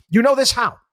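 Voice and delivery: quick scoffing question; incredulous. Real, not acted. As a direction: challenge him mockingly. Host mocking economist's certainty.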